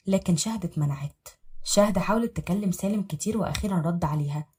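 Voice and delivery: plain reading voice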